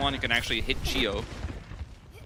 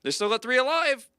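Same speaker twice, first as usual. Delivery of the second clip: higher, doubtful self-quote; retold hesitation; 9 semitones higher than usual. Caster recalling doubts about the cap, retold hesitation. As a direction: replay your own doubt, pitched up. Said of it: "I said it with hesitation".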